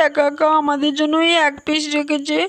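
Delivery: normal talking voice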